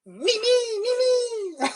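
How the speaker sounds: very high, slow, wailing call of her name; mock Mimi call. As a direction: stretch the name out in a high teasing wail. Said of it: "But if I could talk for her, I'd say".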